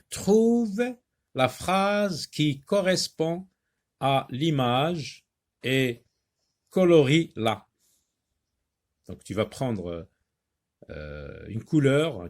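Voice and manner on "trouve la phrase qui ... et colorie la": slow, spaced-out teacher's dictation voice; deliberate reading for young pupils; reading out instructions. Shown as a demonstration. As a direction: read the task word by word, slowly. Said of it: "lire la consigne de l'exercice".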